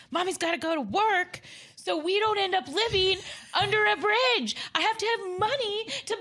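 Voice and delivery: high, loud, laughing mom rant; shrill stressed-out mom, half laughing; frazzled mom voice. Retold or imitated. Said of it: "You know what I said?".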